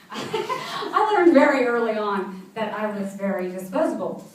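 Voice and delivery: laughing while saying it, drawn out slowly; laughing through it. Real, not acted. Telling the host of her childhood, laughing slowly. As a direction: let a rueful laugh carry the words slowly. Bitter joke about being disposable.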